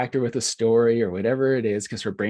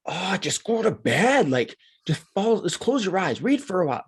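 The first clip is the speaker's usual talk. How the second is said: mock the tired parent brushing her off; exasperated parent; 9 semitones higher than usual. Parents telling their sleepless daughter to go to bed, exasperated whine. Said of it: "where we're just like".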